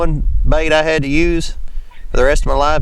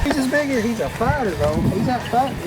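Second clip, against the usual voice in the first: higher, excited and breathless; fighting a fish; 6 semitones higher, 7 dB quieter than usual. Real, not acted. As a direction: marvel while the fish pulls.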